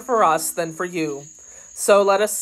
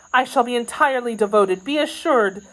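higher, earnest maid's voice; grateful maid; 7 semitones higher than usual. Acted. Kitty promising devotion to her new lady, grateful. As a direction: vow devotion in a raised, eager pitch.

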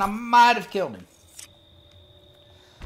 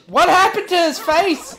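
laughing; 8 semitones higher, 4 dB louder than usual. Real, not acted. Laughing at a face.

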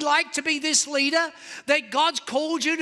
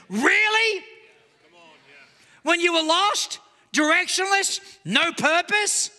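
sarcastic disbelief; 3 semitones higher than usual. Acted. Preacher scoffs at Christians' complaint.

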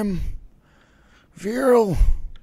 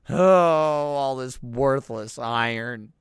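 ordinary talk

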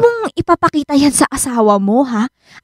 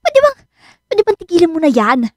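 exasperated; 6 semitones higher than usual. Acted. Friend fed up with Robin.

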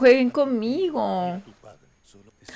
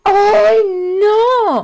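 high, loud wail of protest; dismayed outcry; 10 semitones higher, 6 dB louder than usual. Real, not acted. Viewer upset by the show, loud high cry.

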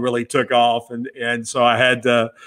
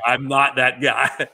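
laughing; slower than usual. Retold or imitated. Joking self-deprecation to friend.

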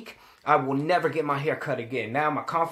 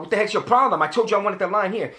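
loud, higher, heated "what the heck"; angry retort; 5 semitones higher, 5 dB louder than usual. Retold or imitated. Customer yelling at barber over ruined line, loud and angry. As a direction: snap at the barber who botched it. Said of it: "I was pissed".

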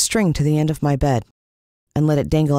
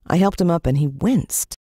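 ordinary delivery

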